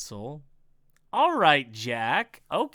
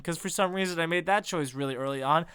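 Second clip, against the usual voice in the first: ordinary delivery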